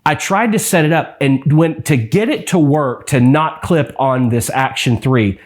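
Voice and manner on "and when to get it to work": usual speaking voice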